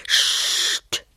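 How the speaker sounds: sst, hissed as a warning; warning hush. A narrator's reading. Thief hushing his partner in a dark house, sharp hiss. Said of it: "warnte Otto".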